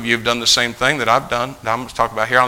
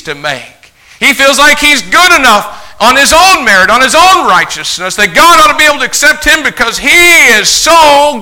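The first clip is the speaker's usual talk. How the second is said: loud, high-pitched pulpit indignation; preaching at full force; 11 semitones higher, 10 dB louder than usual. Acted. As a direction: preach it hard, stretching the key words.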